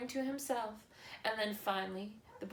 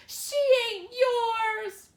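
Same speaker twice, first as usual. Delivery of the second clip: much higher, louder, slow wailing cry; boy screaming at his father over his dead sister; anguished scream; 13 semitones higher, 12 dB louder, much slower than usual. A narrator's reading. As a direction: scream it through tears. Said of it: "Bryce screamed".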